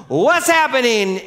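loud, high shout of rushing doctors; alarmed shout. Retold or imitated. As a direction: act out doctors bursting in.

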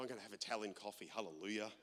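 fast private aside to himself; muttered thought. Retold or imitated. Pastor recalling his morning in Italy, quick soft thought.

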